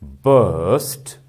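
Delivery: "burst" drawn out with a firm, strong onset; loud stressed syllable. Shown as a demonstration. Teacher showing students a loud syllable, stressed demonstration. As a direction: hit "burst" as one stressed, stretched syllable. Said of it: "look at this".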